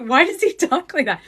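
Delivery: giggly mock bewilderment; amused complaint. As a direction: ask it through laughter, baffled. Laughing quote of Kim's complaint.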